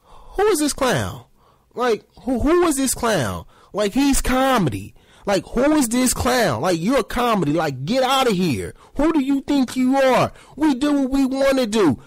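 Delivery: high, scornful team voice brushing off a player; mock the front office sneering at him; scornful team exec. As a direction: team boss scoffing at a nobody player, high pitched. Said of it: "if you're the organization, you like".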